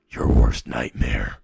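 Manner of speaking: very low, loud demonic voice; menacing nightmare voice. Acted. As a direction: loom right beside the victim, deep and loud. Menacing voice beside her in nightmare.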